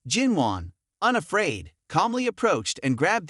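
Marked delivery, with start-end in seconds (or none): none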